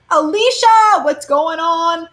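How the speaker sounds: high, loud call to a viewer, then slow; light up at seeing a name in chat; excited greeting. Real, not acted. Reader spots a viewer in chat, excited call.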